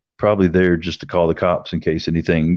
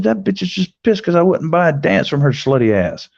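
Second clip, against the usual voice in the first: indignant complaint; 11 semitones higher than usual. Retold or imitated. Complaining to bouncer, outraged.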